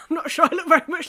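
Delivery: fast, laughing aside. Real, not acted. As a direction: laugh it out quickly. Laughs at own duck.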